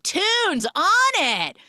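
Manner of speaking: high, loud, slow drawn-out party shout; mock hype shout; party call. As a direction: call for music like a party host.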